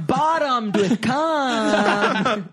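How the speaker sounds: slow laughing over words. Real, not acted. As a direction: drag it out laughing. Crude joke, laughing.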